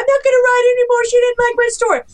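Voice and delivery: high, fast, mock-crying wail; whiny new author. Imitating a crushed new author, high and whiny. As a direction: play a devastated beginner, squeaky and quick. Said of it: "they went".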